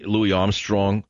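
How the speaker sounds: ordinary delivery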